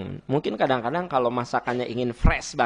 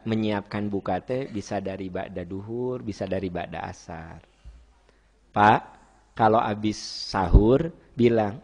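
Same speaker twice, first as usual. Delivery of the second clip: ordinary talk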